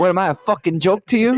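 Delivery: high-pitched, hammed-up wiseguy; Joe Pesci. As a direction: act the offended movie gangster. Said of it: "Joe Pesci's coming to town".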